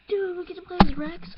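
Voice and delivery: high, loud thrilled question; overexcited gamer squeal; too excited. Real, not acted. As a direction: burst out with delight at the news. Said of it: "I got too excited there".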